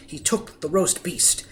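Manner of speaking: ordinary delivery, nothing marked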